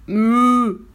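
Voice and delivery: long, loud moo; cow moo. A narrator's reading. Cow under the farmer's bed, loud moo. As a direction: moo it out at full volume.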